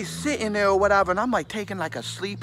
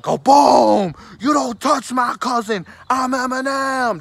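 loud mock yell, pitched up; hammed-up childhood battle cry; mock shout; 6 semitones higher, 6 dB louder than usual. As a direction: bellow it like a cousin defending you. Cousin retells M defending him.

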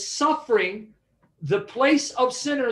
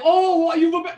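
higher, louder mock wail of abandonment; mock despair; 7 semitones higher, 5 dB louder than usual. Pastor mimicking a wrong reading, mock despairing wail. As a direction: ham up a despairing cry. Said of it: "He's not saying".

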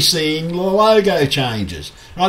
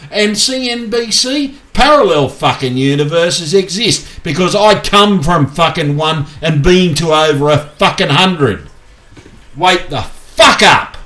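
loud, raised angry yelling, slowing on the last words; furious shout; 5 semitones higher, 5 dB louder than usual. Real, not acted. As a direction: blast it at them as a real outburst. Shouting at CNBC in a rant.

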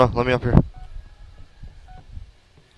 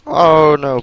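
panicked yelp; 8 semitones higher, 14 dB louder than usual. Real, not acted. Monster catching up.

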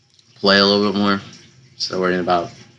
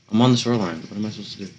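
usual speaking voice

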